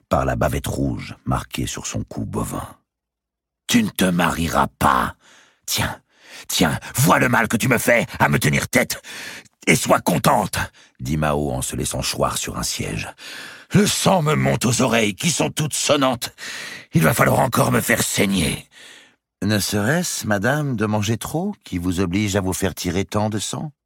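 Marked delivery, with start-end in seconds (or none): 3.7-10.6 forceful outburst climbing high in pitch; angry reproach. A narrator's reading. Countess forbids and reproaches her. 13.8-18.7 high, indignant wailing grumble; agitated complaint. A narrator's reading. Countess complains of rising blood. 19.4-23.7 high, controlled servant's question; needling lady-in-waiting. Acted. Béatrice needles the countess.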